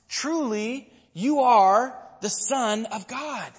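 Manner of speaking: high, slow, ringing declaration; awed proclamation. Retold or imitated. Disciples answering after the rescue at sea, slow high-pitched awe. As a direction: proclaim it slowly, pitched well up.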